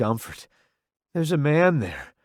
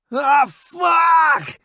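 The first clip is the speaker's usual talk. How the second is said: high, loud agonised curse; pained scream; 13 semitones higher, 6 dB louder than usual. A narrator's reading. Roddy holding his shot leg, pained scream. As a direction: scream it as the bullet hits. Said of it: "he screams".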